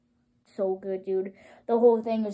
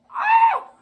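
very high, loud shriek; excited scream; 6 dB louder than usual. Real, not acted. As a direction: let out a piercing fan scream. Screams at the music.

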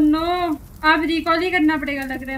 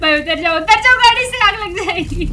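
loud, higher-pitched alarm to a teammate; urgent warning; 4 semitones higher, 5 dB louder than usual. Real, not acted. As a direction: call out to get them clear fast. Warning teammate of car fire. (घबराकर ज़ोर से चेतावनी)

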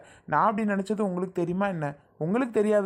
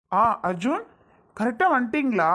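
pleased surprise; 5 semitones higher than usual. Acted. Groom greets unexpectedly arriving friend.

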